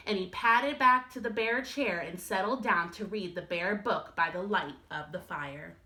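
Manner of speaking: usual speaking voice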